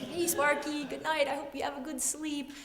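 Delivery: put-on high, soft little-kid voice; tender goodnight. Child alone at night by the lizard's cage, tender. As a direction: coo softly to a sleeping pet.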